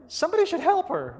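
pitched well up, half-pleading; a sudden jolt of alarm, voiced high; urgent inner thought.

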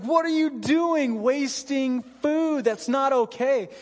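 slow, high mock outrage; parents freaking out. Parents scolding son for wasting food, high mock outrage. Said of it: "my parents would freak out".

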